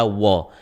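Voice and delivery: "wa" said as "wo", no l at all; wrong w for l. Shown as a demonstration. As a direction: show the mistake "wa", lips rounded, tongue idle. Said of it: "tidak dibaca wa".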